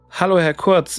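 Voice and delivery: ordinary delivery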